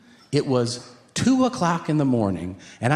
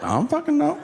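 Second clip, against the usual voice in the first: exasperated; 13 semitones higher, faster than usual. Retold or imitated. Exasperated answer.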